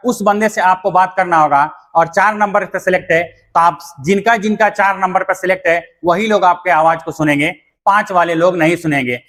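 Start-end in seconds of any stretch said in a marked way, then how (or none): none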